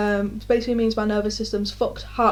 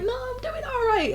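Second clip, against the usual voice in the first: very high, softer, sing-song; falsely breezy denial; 13 semitones higher, 5 dB quieter than usual. Retold or imitated. Insisting to mates she's fine, high and sing-song. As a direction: chirp it, pretending she's fine. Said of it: "I'm like".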